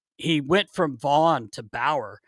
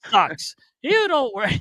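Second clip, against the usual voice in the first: high, put-on grumbling voice; imitated goalie rant; 10 semitones higher, slower than usual. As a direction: grumble it as the offended goalie, pitched up.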